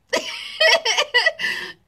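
real laugh, shooting up high; high burst of laughter. Real, not acted. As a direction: laugh out loud at the joke. Laughs at own joke.

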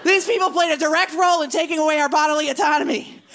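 high-pitched, earnest political rant. Acted. Comic ranting about Hobby Lobby to the crowd, earnest and high. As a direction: preach it to a cheering crowd.

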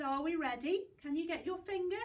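ordinary talk

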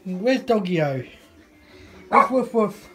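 high, loud sing-song questions. Real, not acted. Asking toddler where the dog is.

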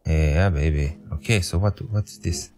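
usual speaking voice

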